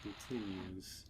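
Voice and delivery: usual speaking voice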